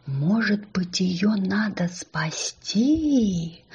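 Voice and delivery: hushed, drawn-out whisper; slow wondering whisper; whispered thought. A narrator's reading. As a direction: whisper a hesitant idea to yourself. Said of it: "подумал маленький ослик".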